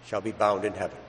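normal talking voice

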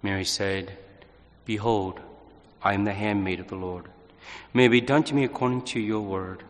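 measured, solemn scripture reading; gospel reading. Acted. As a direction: proclaim the Gospel calmly and steadily. Priest proclaims the Gospel reading.

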